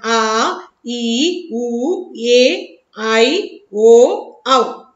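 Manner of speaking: teacher showing two-beat vowels; long vowels demo. Shown as a demonstration. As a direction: stretch each long vowel out fully. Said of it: "ये सब जो है दीर्घ स्वर है".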